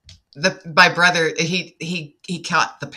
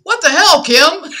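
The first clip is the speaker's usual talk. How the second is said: loud, high exclamation of disbelief; imitating startled brother; 13 semitones higher, 9 dB louder than usual. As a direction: act out a brother's alarmed outburst. Brother's startled retort, retold.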